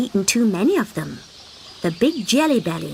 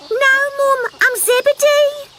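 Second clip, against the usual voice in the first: high wheedling little frog; pleading little frog; 5 dB louder than usual. Acted. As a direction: beg your mum sweetly. Frog correcting mother.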